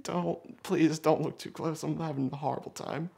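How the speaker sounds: high, small, hushed plea; pleading under breath. Retold or imitated. Limping man wanting no one looking, hushed high plea. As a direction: beg softly in a pinched high voice.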